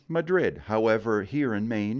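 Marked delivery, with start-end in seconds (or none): 0.1-0.6 Madrid, stress on the second syllable, ma-DRID; standard Madrid. Shown as a demonstration. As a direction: say Madrid like the Spanish capital. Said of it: "like the capital of Spain".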